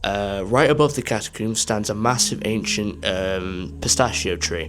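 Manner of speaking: projected, low travel-video narration; documentary voice-over. Real, not acted. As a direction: narrate the site's history to viewers. Recorded voice-over about the site.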